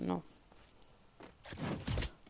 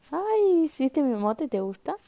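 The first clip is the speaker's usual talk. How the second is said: delighted ay; 8 semitones higher, 4 dB louder than usual. Real, not acted. Reacting to a chat emote.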